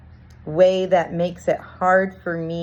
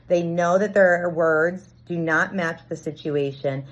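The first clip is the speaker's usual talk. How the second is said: ordinary talk, nothing marked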